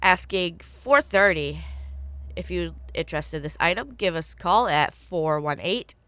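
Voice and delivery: ordinary delivery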